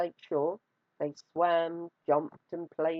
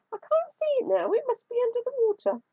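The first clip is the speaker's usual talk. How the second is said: high fast innocent fib; squeaky spider voice; faster than usual. A narrator's reading. Anansi lying innocently.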